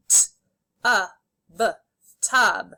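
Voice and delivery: "T, a, b" as isolated sounds, then "tub" whole; sounded out, blended. Shown as a demonstration. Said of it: "say the sounds and then blend".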